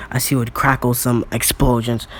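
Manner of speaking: ordinary delivery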